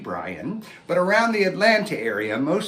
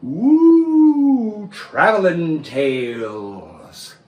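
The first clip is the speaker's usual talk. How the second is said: high ooh, then slow drawn title; showy title reveal; 3 semitones higher, 7 dB louder, much slower than usual. Acted. Puppeteer unveiling his show name to the children, slow and showy. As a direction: present the show name with wonder.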